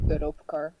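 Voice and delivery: low Dutch-accented pronunciation. Shown as a demonstration. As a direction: say it as a Dutch speaker would. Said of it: "how my Dutch self would say it".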